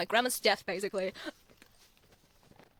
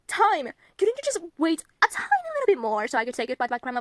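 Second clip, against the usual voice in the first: louder, higher indignant appeal; exasperated plea; 6 semitones higher, 7 dB louder than usual. Acted. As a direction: plead in exasperation, raised voice. Mock pleading with Time over death.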